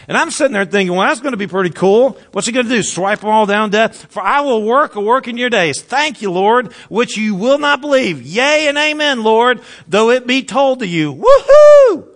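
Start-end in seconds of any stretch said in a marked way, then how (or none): 0.9-11.1 higher, excited running commentary; eager cheering-along. Acted. Listener delighted at God's promise, eager and high. 11.1-12.0 very high, loud whoop; jubilant cheer. Acted. Listener thrilled at God's promise, a high jubilant whoop.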